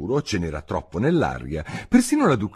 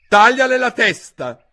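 higher and louder, a sudden command; barked order; 9 dB louder than usual. Acted. Duchess ordering the cook to cut Alice's head off, barked order. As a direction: bark it at the cook.

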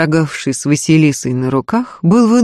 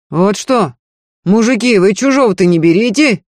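raised, indignant man's shout; yelling; 5 semitones higher, 3 dB louder than usual. A narrator's reading. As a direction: shout a protest at the other men. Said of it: "заорал Егор".

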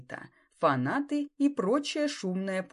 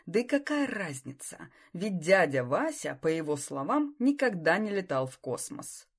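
ordinary delivery, nothing marked

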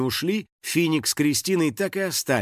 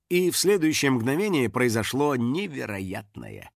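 ordinary delivery

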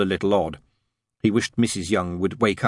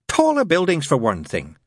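higher nun voice, mildly desperate; 7 semitones higher than usual. A narrator's reading. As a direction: grasp for small talk, a touch desperately. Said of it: "said Sister Mary desperately".

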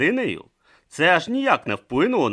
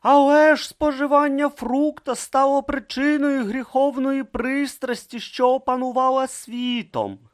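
pious remark; 5 dB louder than usual. Acted. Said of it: "зазначив Бруто".

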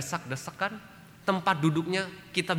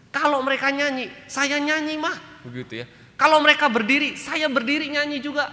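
high, loud, cocky retort; smug husband; 12 semitones higher, 8 dB louder than usual. Retold or imitated. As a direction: play the husband who thinks he's clever. Husband boasts about TV worship.

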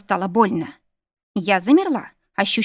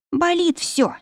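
high, plaintive moan of pain; 9 semitones higher, slower than usual. A narrator's reading. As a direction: groan it out as if everything hurts. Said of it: "простонала я, тяжело дыша". (жалобно стонет от боли)

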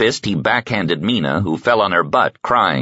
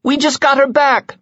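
put-on much higher, louder child's scream; a small girl shrieking for her mother; screaming little girl; 4 dB louder than usual. A narrator's reading. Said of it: "screamed Mina".

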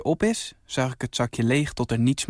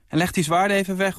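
fast, higher call from across the garden; a mother calling from the terrace, quick and raised; 6 semitones higher, faster than usual. A narrator's reading. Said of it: "roept ze".

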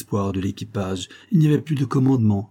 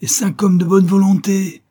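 loud, high call for volunteers; shouting; 10 semitones higher, 7 dB louder than usual. A narrator's reading. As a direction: shout it to the whole crew. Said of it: "cria-t-il". (appel crié aux volontaires)

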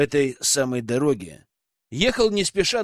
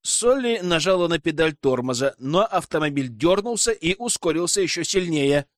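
ordinary delivery, nothing marked

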